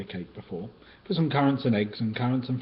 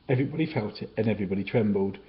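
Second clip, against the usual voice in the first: plain reading voice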